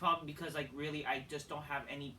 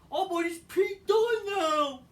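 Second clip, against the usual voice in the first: high, loud, drawn-out mock cheer; hammed-up high sarcastic shout; sarcastic excitement; 13 semitones higher, 9 dB louder, slower than usual. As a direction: yell it slowly like a gushing fan. Sarcastic mock excitement over name.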